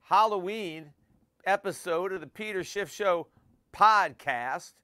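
folksy cowboy howdy greeting. Acted. Halloween episode in cowboy costume.

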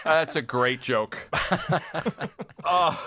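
slow words through laughter; laughing. Real, not acted. Host repeating praise of the joke, slow and laughing. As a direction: laugh it out slowly.

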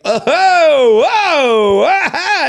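hammed-up gawking noises; mock ogling exclamations. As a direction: imitate a guy gawking at a woman. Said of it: "someone was like".

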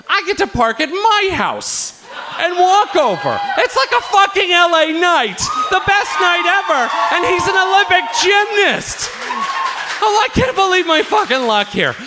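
giddy, hammed-up shouting; elated. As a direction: crow about your incredible luck. Elated about his luck that night.

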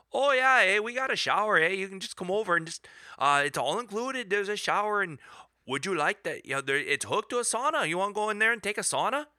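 high-pitched, eager imitation of a store owner; mock an over-helpful host, pitched well up; eager offer. Store guy Rob offering travellers a shower, imitated as eager and high-pitched.

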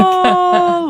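high, loud drawn out oh; mock exasperation. Listeners reacting to the chaos, high exasperated oh. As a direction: sigh it up high like a worn out parent.

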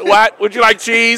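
high garbled intercom voice; drive thru speaker. Pretend. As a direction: squawk it through a tinny drive-thru box. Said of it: "McDonald's drive-through speakerphone".

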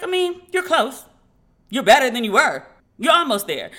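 half-mocking her father's careful judgement; imitating dad. Voicing dad's verdict.

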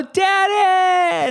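put-on high excited child's shout of Daddy; child's joyful shout; kids shouting daddy. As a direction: squeal it like a happy kid greeting dad. Kids greeting dad coming home.

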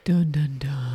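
whispered dun dun dun; mock dramatic sting. Wife adding a mock spooky sting to the story. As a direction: breathe a spooky suspense sting. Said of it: "Pause for dramatic effect".

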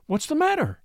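high, alarmed question; alarmed cry. Acted. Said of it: "she cried".

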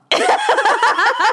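high burst of laughter. Real, not acted. Laughing at own joke.

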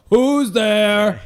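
high, loud crowd reply; a whole audience shouting back; crowd calling back. Retold or imitated. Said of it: "the whole two hundred people".

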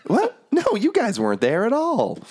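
very high-pitched character voice; put-on high voice, baffled denial; Lulu. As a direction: object in a squeaky high voice. Game master answers as Lulu.

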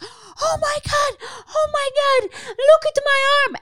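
imitating a frantic woman shrieking about her arm; high panicked mock wail. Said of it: "She's like".

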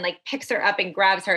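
ordinary talk

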